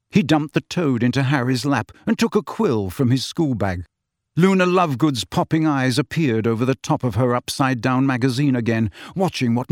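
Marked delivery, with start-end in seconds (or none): none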